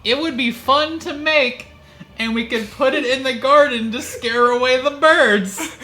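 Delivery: high, loud giddy pitch; giddy excitement. Acted. Hyped retelling of dialogue.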